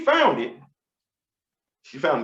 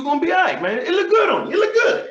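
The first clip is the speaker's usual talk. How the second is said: much higher, shouted encouragement; hyped-up reassurance; 10 semitones higher than usual. Retold or imitated. Barber shouting that the bald head looks good. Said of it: "He was like".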